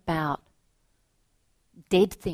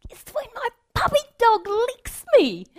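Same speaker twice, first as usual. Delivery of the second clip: put-on much higher, louder squeaky child voice; excited little girl; 10 dB louder than usual. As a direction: gush like a thrilled small girl. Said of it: "another girl said".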